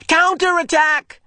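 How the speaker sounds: high, slow loud shout; opposing side shouting in game; battle cry. Acted. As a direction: answer the attack. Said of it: "an opposing shout".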